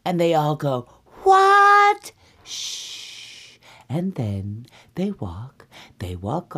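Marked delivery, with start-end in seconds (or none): 1.2-2.1 very high, loud drawn-out squeal; piercing all-bears outcry; shocked shriek. Acted. All three bears react in shock. 2.4-3.6 soft, drawn-out shush; quiet storybook shush; long shushing sound. Acted. Bears hush each other.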